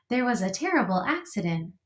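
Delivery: plain reading voice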